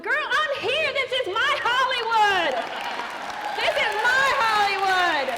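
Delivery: high, slow, loud, laughing proclamation; proud laughing boast. Real, not acted. Answering the Hollywood question, proud laughing boast. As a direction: crow it to the crowd with a laugh.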